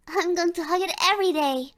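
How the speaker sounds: normal talking voice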